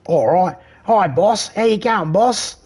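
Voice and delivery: very high, hammed-up Aussie accent; exaggerated Australian accent. Voice actor obeying the booth, exaggerated Australian accent. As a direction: play up a cartoonish Australian twang. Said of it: "We want more Croc Dundee, more Australian".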